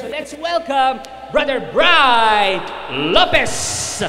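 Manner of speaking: loud, slow emcee welcome; announcer intro. Real, not acted. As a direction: hype up the next speaker. Announcing the next preacher.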